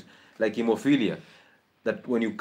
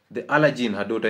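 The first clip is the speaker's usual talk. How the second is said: normal talking voice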